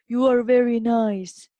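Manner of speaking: loud echo of the father's call; shouted echo reply. A narrator's reading. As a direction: answer loudly as the mountain voice. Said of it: "the voice responded the same".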